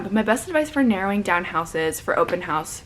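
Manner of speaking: normal talking voice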